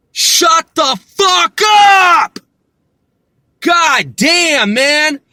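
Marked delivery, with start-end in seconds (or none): 0.1-2.4 slow, loud, higher yell, every word hammered; furious shout. Real, not acted. Yelling at Britain over Brexit, furious slow shout. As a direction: explode at them, done with it all.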